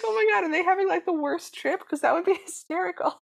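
high and giggly; cracking up at the thought; laughing. Retold or imitated. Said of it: "And I was dying".

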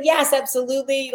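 high retold burst of enthusiasm; eager agreement. Retold eager acceptance of invitation.

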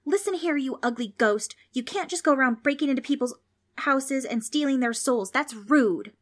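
high, loud indignant telling-off; scolding. A narrator's reading. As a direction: let them have it, pitched high and forceful. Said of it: "Trix let the ghost have it".

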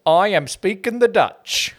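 high, slow, hammed-up fake Dutch accent; silly put-on Dutch accent; mock Dutch accent. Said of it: "Hers was like".

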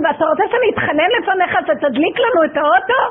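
scolding heaven on behalf of a broken family; furious outburst. Retold or imitated. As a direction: let the anger burst out. Shouting at God in anger.

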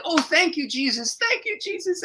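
high, fervent thanks; overwhelmed thanksgiving. Retold or imitated. Couple on their knees thanking Jesus, high and overwhelmed. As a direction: give thanks in a high, overcome voice.

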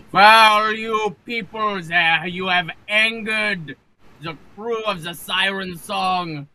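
put-on slow, high-pitched drunken captain's oration; loud rousing speech. Drunk captain yelling at dock people, loud indignant speech. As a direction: proclaim it grandly and haltingly, pitched high. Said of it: "make my voice as loud as I possibly can".

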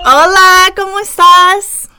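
high, loud, bubbly hello; excited greeting. Real, not acted. Host greeting her guest on the phone, thrilled. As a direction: greet her friend with a squeal of joy.

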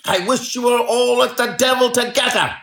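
high-pitched, forceful salesman's tirade; angry shouting. A narrator's reading. Salesman warning off a pestering man, shouting angrily. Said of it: "he shouted".

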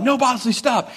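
high, urgent mock alarm; retold dog scolding. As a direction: call off a puppy in mock panic. Retelling calling out to his dog.

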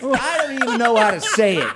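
loud, high laughing protest; laughing helplessly. Real, not acted. Stumped speaker confessing to the panel, laughing. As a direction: laugh through being stumped.

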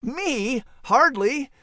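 squeaky high indignant denial; surprised denial. Real, not acted. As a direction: caught out, protest in a high voice. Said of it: "have you been doing some naughty nibbling".

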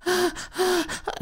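a sharp, very high frightened gasp; very high scared gasp. Retold or imitated. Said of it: "'cause it was so scary".

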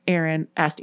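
ordinary delivery, nothing marked

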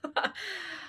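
short laugh, high and soft; high nervous laugh. Real, not acted. After the last-day-on-earth question, a high nervous laugh. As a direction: laugh off the shock.

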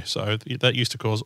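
ordinary talk, nothing marked

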